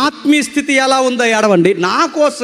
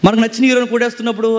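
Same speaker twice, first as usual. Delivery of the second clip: ordinary talk, nothing marked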